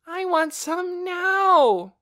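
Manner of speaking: put-on high, very slow whiny child; whining. A narrator's reading. Hungry little girl whining for food. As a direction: drag it out like a hungry toddler. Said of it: "Poppy whined".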